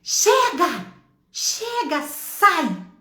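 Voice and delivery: high repeated yells, easing on the last word; re-enacted shout. Mother repeating her rebuke to the enemy, shouting. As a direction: cry out commands to drive something away. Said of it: "eu gritei tanto".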